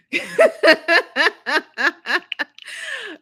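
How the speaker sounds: long, high, loud cackle; helpless loud laughter; real laugh. Real, not acted. Laughs at own [name] theory.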